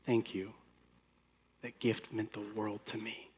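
soft, low and heartfelt; birthday host thanking a giver, hushed and tender; tender thanks. Retold or imitated. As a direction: thank them gently, almost breathless. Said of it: "they say this".

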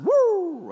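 loud high siren whoop; imitated police siren. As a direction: wail like a cop car's siren. Imitates police siren behind his van.

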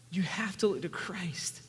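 slow, whispered plea. Real, not acted. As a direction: whisper it slowly, drawing them in. Hushed plea in sermon.

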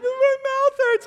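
high, loud mock kid wail; whining child. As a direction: imitate a child crying out in complaint.

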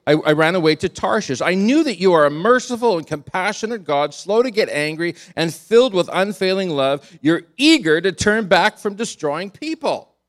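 loud, high, bitter complaint thrown at God; angry Jonah. Retold or imitated. Preacher voicing Jonah hurling words at God, loud and bitter. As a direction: fling the words at God like an insult. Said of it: "Jonah is throwing those words at God".

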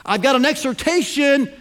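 high, loud call for attention; announcing loudly to get everyone listening. Retold or imitated. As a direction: grab the crowd's ears before the command. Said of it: "the emphasis should be at the beginning".

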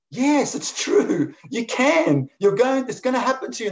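high butterfly pleading, stretching the first words out. Acted. Butterfly assuring the doubting caterpillar it can change, very high and insistent.

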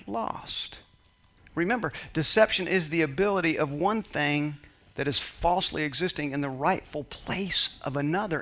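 usual speaking voice

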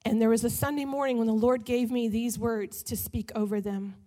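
usual speaking voice

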